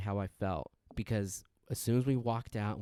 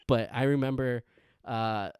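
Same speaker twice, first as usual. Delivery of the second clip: ordinary talk, nothing marked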